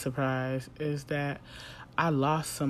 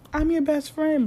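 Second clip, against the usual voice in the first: mocking Molly; 12 semitones higher, 6 dB louder than usual. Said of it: "Molly, was like".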